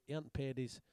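normal talking voice

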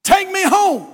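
put-on boy begging his friends to take him home. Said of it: "he said".

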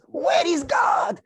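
slow, loud, high mocking yell; officer mocking God, shouted high and slow; cursing shout. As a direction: bellow the taunt slowly at heaven. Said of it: "And he was cursing God".

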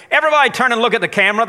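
loud, high, quick call to a crowd; rally the whole room, loud and fast. Real, not acted. Pastor getting the congregation to face the camera, calling out.

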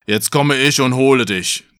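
lightly voiced troll threat; a hint of a menacing troll; mildly put-on troll voice; troll calling out. A narrator's reading. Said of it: "Da rief der Troll".

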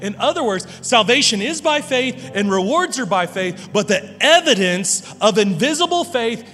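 preaching at full force. Acted. Pastor hammering home a quote, full-force preaching. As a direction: drive each phrase home from the pulpit.

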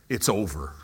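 ordinary delivery

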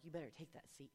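soft, hushed inner thought. Retold or imitated. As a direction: say it to yourself under your breath. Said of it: "in my mind, I'm like".

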